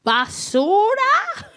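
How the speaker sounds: high, slow questioning son; son's question. Retold or imitated. Son asking about trash, high and slow. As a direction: ask it like her son, drawn out.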